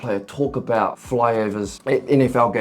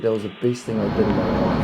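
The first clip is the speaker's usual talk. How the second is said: imitating the pilot; 5 semitones lower, faster than usual. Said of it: "He said".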